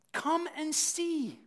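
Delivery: gentle invitation. Retold or imitated. Philip invites Nathanael.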